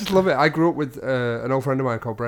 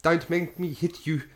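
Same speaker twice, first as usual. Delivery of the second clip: slow, higher South African mum's warning; South African accent; 5 semitones higher, slower than usual. Retold or imitated. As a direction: warn slowly like a South African mother. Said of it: "all I remember was her going".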